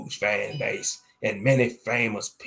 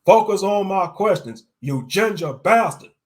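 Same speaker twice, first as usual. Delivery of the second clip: angry; 9 semitones higher, 8 dB louder than usual. Acted. Prisoner interview gets heated.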